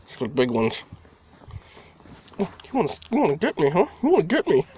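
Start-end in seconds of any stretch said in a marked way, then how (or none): 2.4-4.7 high, sing-song voice egging the cat on; playful cat teasing. Real, not acted. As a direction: tease the cat like a toy is dangling. Playing with the cat.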